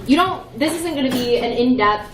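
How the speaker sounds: ordinary delivery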